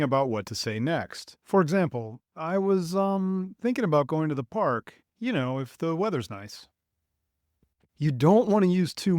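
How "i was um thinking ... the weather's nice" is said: natural hesitation with small filler words; thinking with fillers. Shown as a demonstration. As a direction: think aloud, then run on casually. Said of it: "use small filler words when you're thinking".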